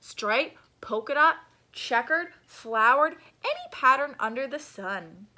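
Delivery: loud excited salesman; eager pitch. Acted. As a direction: sell every pattern with glee. Shopkeeper pitching patterns.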